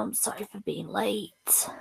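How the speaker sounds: voiceless, soft whisper of a lost voice; barely any voice left, just breath. Real, not acted. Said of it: "I've basically lost my voice".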